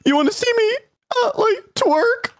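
very high, goofy cartoon voice; Shaggy. Streamer joking with friend about twerking, high cartoon impression. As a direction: squeak it out in character. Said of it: "my Shaggy voice".

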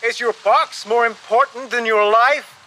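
high, incredulous call; shouted question. A narrator's reading. Narrator challenging passenger on the sinking ship, shouting. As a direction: throw the question at him urgently. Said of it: "I shouted".

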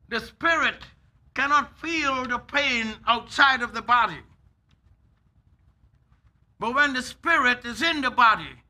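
loud, emphatic sermon delivery with long pauses; forceful preaching. Acted. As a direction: preach it hard to the congregation.

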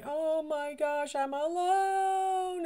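put-on high, slow, dismayed baby voice. Baby left alone in the room, high and slow. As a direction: voice the baby realizing it's alone.

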